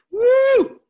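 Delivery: high, loud cheerful whoop; excited whoop. Real, not acted. Announcing next event cheerfully.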